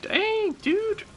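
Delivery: high mock-amazed squeak; impressed reaction. Sibling reacting to brother's German, high-pitched mock amazement. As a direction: pitch it way up, imitating awe.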